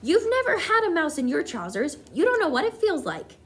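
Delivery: high indignant protest; indignant shout. A narrator's reading. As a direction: defend yourself loudly. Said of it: "the man was shouting back".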